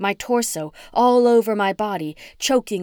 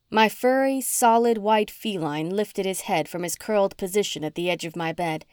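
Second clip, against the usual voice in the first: ordinary delivery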